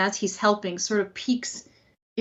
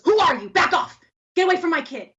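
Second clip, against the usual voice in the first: louder, higher protective yell; angry shout; 7 semitones higher, 7 dB louder, faster than usual. Acted. Father shouting to protect his son from an intruder. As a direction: bark at the stranger to back off.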